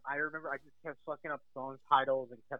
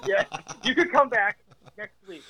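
laughing repeat; 6 semitones higher, 6 dB louder than usual. Retold or imitated.

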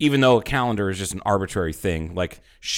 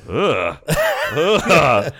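loud mock "ugh"; imitated disgusted groan; 5 semitones higher, 6 dB louder than usual. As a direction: groan it out like a revolted man. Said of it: "he's just like".

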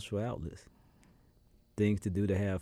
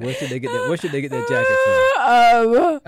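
squeaky laughing question, much higher and louder; 14 dB louder than usual. Real, not acted. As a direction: ask it while cracking up. Asks while laughing hard.

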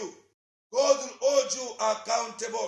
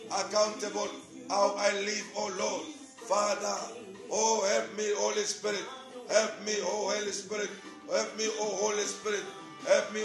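softer prayer over music, repeating pleas; fervent prayer; 2 semitones lower, 6 dB quieter than usual. Acted. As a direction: pray aloud, leading the church to God.

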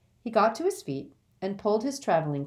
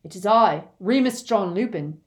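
loud, projecting through a door; calling over wind; 3 semitones higher, 8 dB louder than usual. Acted. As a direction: shout over a howling wind. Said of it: "called a voice over the howling wind".

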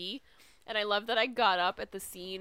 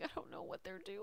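whispered; 13 dB quieter than usual. Retold or imitated. Retold puzzled thought, whispered.

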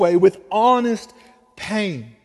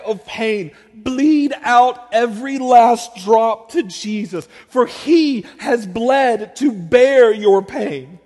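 slow preaching build; 5 dB louder, slower than usual. Acted. Preaching climax on pouring out pain.

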